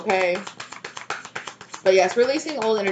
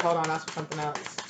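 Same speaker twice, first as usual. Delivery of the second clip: softer, lower, otherworldly aside; voicing Spirit; 3 semitones lower, 6 dB quieter than usual. Spirit telling the reader to wait, softer lower voice. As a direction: relay the spirit's quiet correction. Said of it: "They said".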